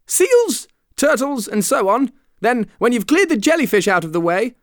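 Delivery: high, excited storybook voice; excited explaining. A narrator's reading. Mock Turtle explaining the dance to Alice, excitedly. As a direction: rattle off the list in a high, eager pitch.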